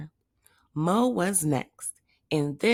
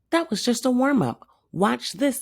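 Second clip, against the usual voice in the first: high, fast, louder eager boast; excited boast; 8 semitones higher, 3 dB louder, faster than usual. Acted. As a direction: rush to prove them wrong. Hamster boasting to cat.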